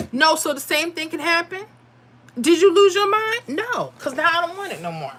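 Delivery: retold indignant refusal; indignant retort. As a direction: fire back in mild mock outrage. Acted retort to delivery offer.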